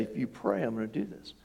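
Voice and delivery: quiet, lightly voiced bully threat; mock bully. The devil bullies him over praying, understated mock threat. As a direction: puff out the chest, but keep it soft. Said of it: "puff his chest out and say".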